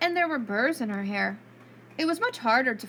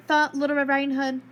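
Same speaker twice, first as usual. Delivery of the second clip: ordinary delivery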